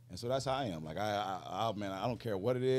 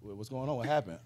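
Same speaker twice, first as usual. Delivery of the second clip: 1 startled brother; 5 semitones higher, 15 dB louder, slower than usual. Retold or imitated. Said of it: "I said".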